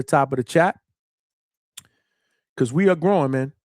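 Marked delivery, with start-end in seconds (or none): 2.6-3.3 "we" leaned on, falling off the top; stress on we. Shown as a demonstration. Host to his chat, hitting "we" hard. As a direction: punch the word "we" so it rings out. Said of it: "I emphasize the word we".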